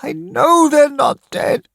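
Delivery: high, loud insistent shout; frantic mother shouting. Acted. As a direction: shout down the truth.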